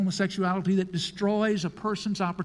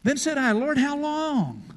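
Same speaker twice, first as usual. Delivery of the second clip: high, loud anguished plea; Isaiah's plea; 9 semitones higher, 4 dB louder than usual. Acted. Isaiah asking the Lord how long, high plea. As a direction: cry out to the Lord. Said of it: "Verse eleven".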